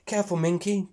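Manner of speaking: high little bird calling out; chirpy warning. Acted. Bird calling to slipping piglet, chirpy high warning. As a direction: warn her like a fretful bird. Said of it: "tweets Skeet".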